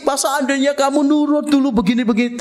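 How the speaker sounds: high sing-song nagging; mock nagging sing-song complaint; nagging wife. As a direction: whine it like a complaining wife, rising. Mocking a nagging spouse.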